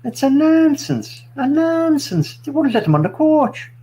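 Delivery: put-on Irish accent. Ranting to his mates about Anthony, Irish accent. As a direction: do an indignant Irishman, pitched well up. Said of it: "That's Irish".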